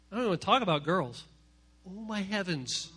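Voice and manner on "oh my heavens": usual speaking voice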